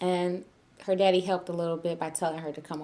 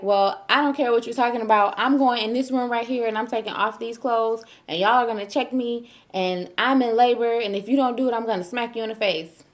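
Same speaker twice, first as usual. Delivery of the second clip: acted-out insistent rant, higher pitched; defiant demand; 4 semitones higher, 4 dB louder than usual. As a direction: retell laying down the law to hospital staff. Retelling her demand to hospital staff.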